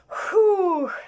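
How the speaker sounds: high, drawn-out "huh" of exhaustion; tired puffing. Acted. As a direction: let out a weary, voiced puff. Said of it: "як робив Том". (втомлене «хух»)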